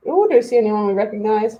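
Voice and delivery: usual speaking voice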